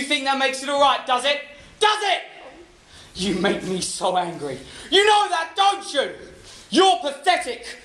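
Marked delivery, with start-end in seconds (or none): 1.8-2.2 higher, sharper repeat of the question; angry demand. Acted. Husband repeats, harsher. 4.9-7.8 jab each insult at her; rising anger. Acted. Husband's rising abuse.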